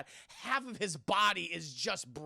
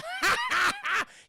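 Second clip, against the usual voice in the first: high, loud mock cackle; hammed-up burst of high laughter; imitated old man's laugh; 7 dB louder than usual. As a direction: cackle like the old man in the film. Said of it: "he was laughing at Kevin Dillon".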